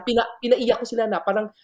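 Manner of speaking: ordinary talk, nothing marked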